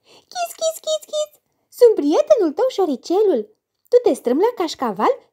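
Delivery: very high, squeaky mouse voice; thin little voice. Acted. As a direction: squeak like a tiny cheerful mouse. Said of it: "vocea subțire".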